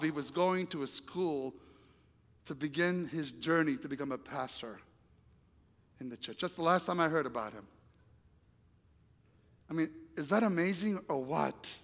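ordinary talk

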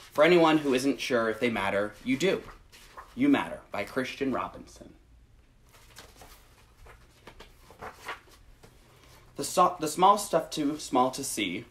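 gentle, measured picture-book read; reading to children. Acted. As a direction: read it slowly to little listeners. Reads picture book aloud.

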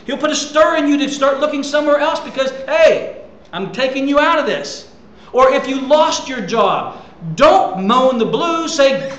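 loud, high, fervent preaching; a preacher at full pitch, rousing the congregation; full-force preaching. Acted. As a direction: drive it home from the pulpit.

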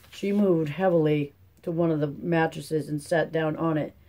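usual speaking voice

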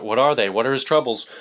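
ordinary delivery

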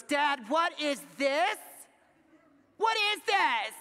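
loud, high, drawn-out accusation; hammed-up indignant questioning; scandalized daughter. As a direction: imitate an appalled kid confronting Dad. Daughter confronts dad over drink.

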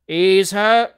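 loud shipboard command; captain's cry. A narrator's reading. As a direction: call the order out to the crew. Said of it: "cried the captain".

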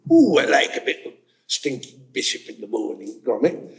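Wallace's cosy northern voice, lightly done; Wallace impression. Speaker quotes Wallace talking to Gromit, mild imitation. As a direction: relish the cheese like Wallace. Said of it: "Wallace was mentioning".

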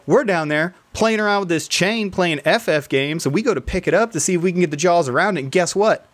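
lightly imitating an excited friend bursting with news; excited retelling. Friend's excited story retold.

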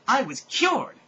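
high, loud jubilant cry; excited. Acted. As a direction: burst out with joy. Narrator rejoicing, cured.

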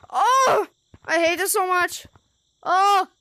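loud, high frightened yelps; startled scream. Real, not acted. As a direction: yelp in real fright at the jump scare. Jump scare in horror game.

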